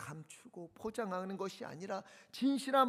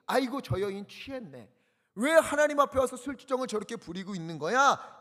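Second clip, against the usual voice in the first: mock scolding old priest, louder; 6 semitones higher, 8 dB louder than usual. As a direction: chide her like a stern priest. Eli scolds Hannah as drunk.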